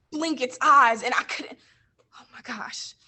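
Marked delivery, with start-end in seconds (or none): 2.2-2.9 breathless, barely voiced whisper; real emotion almost gone silent; overcome whisper. Real, not acted. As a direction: let the words slip out, choked up. Overcome seeing oiled pelican.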